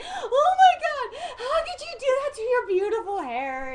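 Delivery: very high, loud hysterical wail; hammed-up, sobbing shriek of horror; mock crying scream. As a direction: wail it like a horrified mom. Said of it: "she was just crying".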